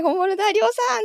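high, thrilled "本物だ"; excited reunion cry. Retold or imitated. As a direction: squeal it, meeting a friend. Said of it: "っつってハグしちゃった". (興奮して高い声で「本物だ」)

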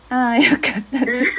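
laughing with relief; relieved laugh. Real, not acted. Coach relieved the listener found it easy to understand, laughing. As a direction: say it quickly through a relieved laugh.

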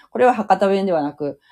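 ordinary talk, nothing marked

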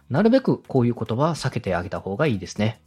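ordinary talk

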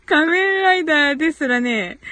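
words squeezed out through helpless laughter; laughing. Real, not acted. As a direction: laugh so hard the words barely get through. Laughing at a viewer comment.

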